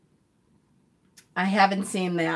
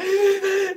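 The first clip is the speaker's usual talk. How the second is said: sharp high gasp, like a sob catching; mock sobbing gasp; 12 semitones higher than usual. Mother retelling crying over a sad book chapter, a sobbing gasp. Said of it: "I'm like".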